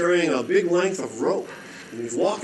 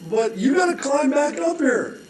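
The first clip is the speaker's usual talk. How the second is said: pleading holder; 11 semitones higher, 3 dB louder than usual. Acted.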